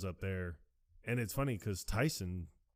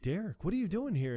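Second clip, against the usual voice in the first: high surprised quote; 9 semitones higher, 3 dB louder than usual. Retold or imitated. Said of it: "she's like".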